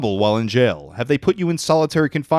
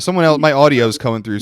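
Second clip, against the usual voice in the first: ordinary talk, nothing marked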